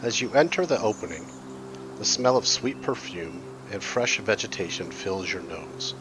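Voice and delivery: calm storyteller's scene setting; scene narration. Acted. Game master's scene setting.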